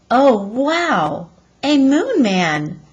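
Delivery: stunned at a strange sight; breathless discovery; amazed gasp. Acted. Annie sees figure through telescope.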